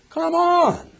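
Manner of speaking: exasperated outburst. Real, not acted. Preacher loudly scoffs at the painted scene. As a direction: throw the idea away, loudly. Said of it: "preacher rejects the pious picture".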